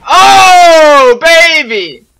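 high, loud triumphant whoop; excited yell. Real, not acted. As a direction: shout it out in pure joy. Celebrating a great play.

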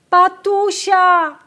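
high, loud, drawn-out call of the name. A narrator's reading. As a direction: call to the sister across the field. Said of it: "zawołała".

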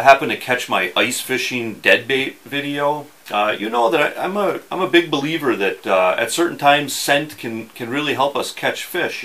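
normal talking voice

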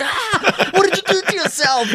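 very high mock-horrified squeal; retold shocked shriek. Bandmates seeing the singer's shaved head, high shocked shriek. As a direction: imitate bandmates shrieking in astonishment. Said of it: "my own band didn't recognize me".

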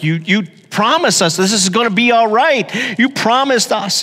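a father reliving his anger at the doctor; furious at the doctor. Retold or imitated. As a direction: throw the broken promise back at him. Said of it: "I'm just mad".

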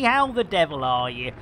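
normal talking voice

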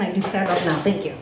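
normal talking voice

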